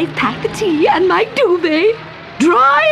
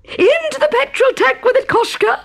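much higher, bright-eyed order; gleeful command. Acted. Great aunt ordering the tea into the tank. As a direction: command it with mad delight.

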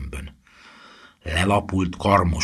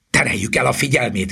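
higher, urgent call; raised-voice command to an ally; shouting; 6 semitones higher, 4 dB louder than usual. A narrator's reading. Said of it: "kiáltotta Harry".

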